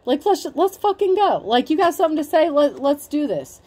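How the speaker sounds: hammed-up fired-up dare; pumped for a fight. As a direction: egg them on, ready to go. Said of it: "And you're like".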